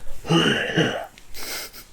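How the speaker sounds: harsh voiceless huff; rasping breath noise in imitation; imitated noisy breathing. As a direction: mimic someone huffing down your neck. Imitates person breathing behind her.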